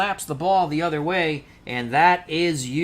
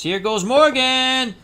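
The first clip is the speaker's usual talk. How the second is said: raised, drawn-out announcer's call; play-by-play call; 9 semitones higher, 7 dB louder, slower than usual. Pretend. Announcer sends the runner toward second, drawn-out play-by-play call. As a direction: call it high and loud, stretching it out. Calling the steal attempt live.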